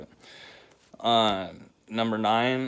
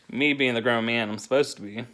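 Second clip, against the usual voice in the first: usual speaking voice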